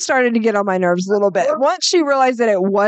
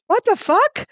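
high shocked outburst, much higher; retold shocked exclamation; shocked what the fuck; 11 semitones higher, slower than usual. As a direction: blurt it out in disbelief. Retold shock at husband's crotch.

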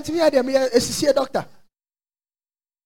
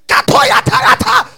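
shouting in tongues; 10 semitones higher, 7 dB louder than usual. Retold or imitated. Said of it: "I was shouting in the bathroom".

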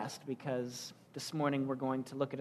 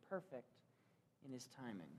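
soft echo; 5 semitones lower, 22 dB quieter than usual. Acted. Preacher repeats phrase, softer echo.

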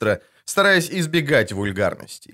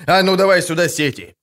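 higher, punchy command; moderately raised, commanding bark; barked order; 6 semitones higher, 4 dB louder than usual. A narrator's reading. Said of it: "рявкнул Агловаль".

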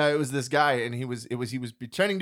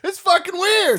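high, loud outburst of disbelief; loud exclamation; 8 dB louder, slower than usual. Acted. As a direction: shout it at the absurdity. Exclaiming at the absurd sketch.

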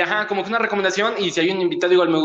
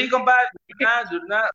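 higher norteño accent, mock-lively; northern Mexican accent; 6 semitones higher than usual. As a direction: imitate a guy from northern Mexico. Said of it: "las personas del norte, pues, sí hablan". (imitando el acento norteño mexicano, más agudo)

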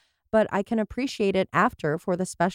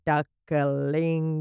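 ordinary talk, nothing marked

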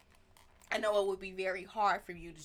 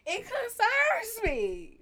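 high, loud, drawn-out dismay; exclaimed concern; 12 semitones higher, 10 dB louder, much slower than usual. Real, not acted. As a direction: stretch the worry out in a high cry. Parent exclaiming over child's diss tracks.

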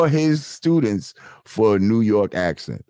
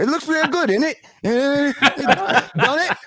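high mock English accent, questioning rise; hammed-up London voice, every line a question. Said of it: "they finish it off with a question".

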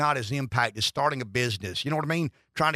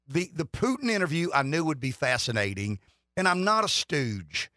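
normal talking voice

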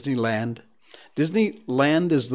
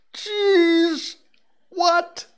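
much higher, startled jeez what; shocked; 4 dB louder than usual. Real, not acted. Host reacting to a creepy reader story, shocked and high. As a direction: yelp it out in disbelief.

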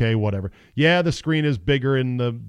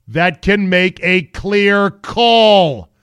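slow, loud and punched; emphatic slow; 6 semitones higher, 9 dB louder, much slower than usual. Pretend. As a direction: drag out each word for emphasis. Mock pitch idea.

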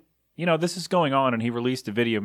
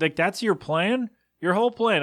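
incredulous scorn; 7 semitones higher than usual. Real, not acted. Mocking the rioters' plan.

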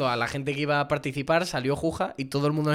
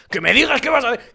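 policía veterano más alto y fuerte, exigiendo respuesta; viejo policía; 8 semitones higher, 8 dB louder than usual. Retold or imitated. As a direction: haz de policía viejo a punto de jubilarse que interroga a gritos. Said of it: "ese policía mayor, canoso, a punto de jubilarse". (el policía viejo interroga al tuitero, alto y fuerte)

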